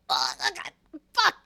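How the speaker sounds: hammed-up panicked cry rising high; mock panic. Streamer imagining a throat-punched kidnapper, mock panicked cry. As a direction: fake a terrified yelp. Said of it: "and you're like".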